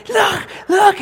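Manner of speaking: imitating an excited kid, very high; high, loud, eager little-boy squeal; excited child. Preacher mimics his son showing off his pulled tooth, excited. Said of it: "he was so excited".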